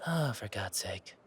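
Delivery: whispered, fed-up sigh of words; exasperated whisper. Acted. Muttering to himself at the sign, whispered in exasperation. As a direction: hiss it under your breath.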